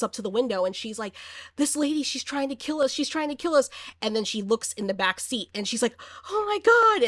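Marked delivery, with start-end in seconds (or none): 1.6-3.7 high, urgent mock plea; imitating panicked wife. Wife warning police of killer. 6.3-7.1 very high, loud gasping cry; mock horrified shriek. Wife spots killer in back seat.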